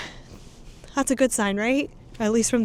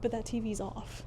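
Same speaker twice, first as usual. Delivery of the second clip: hushed, nervous voice in the dark; nervous night vlog whisper; 11 dB quieter than usual. Real, not acted. Nervous whisper in haunted hotel.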